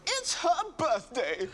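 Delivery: high, drawn-out celebratory cry; imitated excited lady. Lady explaining to a stranger in the lift, high mock excitement. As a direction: mimic her slow, high-pitched announcement. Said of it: "this lady generally turned to me and went".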